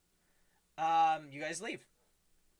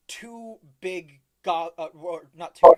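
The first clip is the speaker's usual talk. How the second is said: ordinary talk, nothing marked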